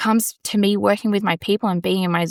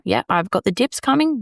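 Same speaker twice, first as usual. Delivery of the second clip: normal talking voice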